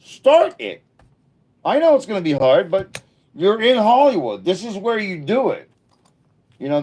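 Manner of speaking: high, loud fired-up urging; excited pep talk. Retold or imitated. Urging backyard friends to chase dreams, high and loud. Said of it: "I'm like".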